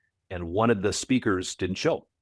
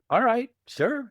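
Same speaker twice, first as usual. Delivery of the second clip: mock casual agreement; 14 semitones higher, slower than usual. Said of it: "I was like".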